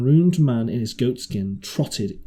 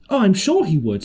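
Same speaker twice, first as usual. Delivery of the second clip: bright, higher eager answer; eager reply; 9 semitones higher, 5 dB louder than usual. A narrator's reading. Jim eagerly reassuring the marooned man. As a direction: jump in eagerly to reassure him. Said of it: "said I".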